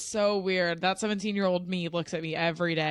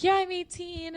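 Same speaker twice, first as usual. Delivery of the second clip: high, bubbly mock excitement about turning eighteen; excited teen; 11 semitones higher than usual. Daughter mimicking her giddy eighteen-year-old self, high and excited. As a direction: squeal it like a thrilled new adult. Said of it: "you're like".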